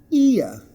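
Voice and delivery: high, falling "ear", ee sliding to uh; two-sound diphthong. Shown as a demonstration. Teacher models the word, "ear" in two sounds. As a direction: say "ear" as ee-uh, dropping the r. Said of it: "Listen to the two sounds".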